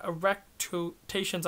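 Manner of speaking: erectations spelled out slowly, syllable by syllable; slow down and land erectations carefully; careful second attempt at erectations; careful slow retry. Shown as a demonstration. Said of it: "I'm gonna try".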